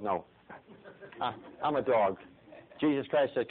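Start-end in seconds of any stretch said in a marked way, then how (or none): none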